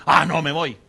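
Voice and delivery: loud, dismissive brush-off as a disciple; mock disciple quitting. Disciples turning away from Jesus, loud dismissive brush-off. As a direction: toss it off loudly like a follower quitting.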